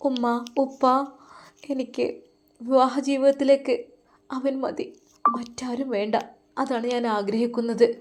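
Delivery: a daughter begging to be understood; lovesick daughter. Acted.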